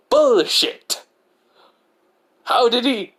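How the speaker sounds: high, loud incredulous outburst; loud disbelief. Acted. Izuku hears his father changed, loud incredulous outburst.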